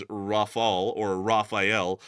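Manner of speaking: Rafale as rah-FAHL, Raphael as rah-fie-EL; chat's corrected pronunciations. Retold or imitated. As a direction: slowly set out Rafale without final vowel, then Raphael in three syllables. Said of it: "trying to correct me".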